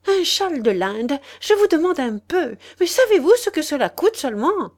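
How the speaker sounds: high, loud scoffing protest; indignant old lady. Acted. Old lady scoffs at the father, high and loud. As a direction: scoff at the price, high and fast.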